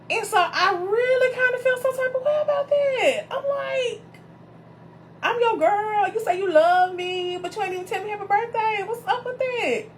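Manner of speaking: high, cracking mock-wounded complaint; high, teary-laughing call-out of her man; hurt and sassy. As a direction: play the offended girlfriend, half laughing. Upset he forgot birthday.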